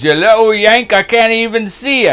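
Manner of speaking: high, loud jeering Irish mate; mock Murphy. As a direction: tease the little yank from way up. Murphy quoted mocking the speaker.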